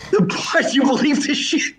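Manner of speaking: high, breaking into a laugh; laughing disbelief. Real, not acted. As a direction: give up mid-word and laugh. Cracks up restarting ruined joke.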